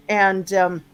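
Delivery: usual speaking voice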